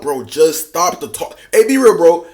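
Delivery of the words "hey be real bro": louder and higher, calling him out; loud call to get real. Real, not acted. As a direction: snap him back to reality. Host scolds the guy.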